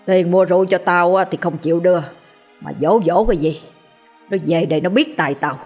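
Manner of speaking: put-on slurred angry drunk cursing; a drunk father scolding his kids; drunken rant. A narrator's reading. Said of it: "lè nhè chửi bới". (say xỉn chửi bới lè nhè)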